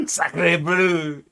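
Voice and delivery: loud hammed-up French accent; mock French exclamation. Streamer exclaims in mock French over the beret. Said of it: "I am French Floppy".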